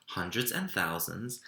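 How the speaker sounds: ordinary delivery, nothing marked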